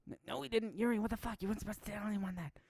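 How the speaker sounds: high-pitched panicked protest; acted squeaky outrage; flustered denial. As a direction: deny it in a high, indignant squeak.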